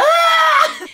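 very high, loud mock scream; imitated scream. Rose slipping off the boat, mock terrified scream. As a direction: shriek like someone dangling, played for laughs. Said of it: "She's screaming".

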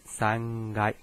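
Japanese "三阶" read san-gai; "三阶" with a voiced gai after san; voiced G, sangai. Shown as a demonstration. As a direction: voice the k of kai to g in "三阶". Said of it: "它就是这样子来念".

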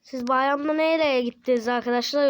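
ordinary delivery

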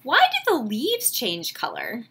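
put-on slow, gentle read-aloud for kids; storybook reading. A narrator's reading. Teacher reading a picture book to her class, slow gentle narration. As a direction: savor each line like a bedtime story.

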